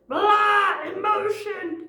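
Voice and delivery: loud, high-pitched wordless-style blurt; hammed-up burst of raw emotion, high and loud; mock emotional outburst. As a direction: spew it out as an exaggerated meltdown. Imitating her undirected emotional outburst.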